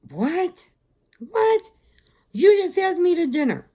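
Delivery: high, loud, incredulous outburst; retold shock. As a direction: squeal it in disbelief, re-enacting the moment. Retold shock at relationship status.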